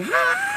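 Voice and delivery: very high squeaked "ha"; failed high note. Shown as a demonstration. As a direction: attempt a falsetto note that will not come out. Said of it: "try to do the higher voices".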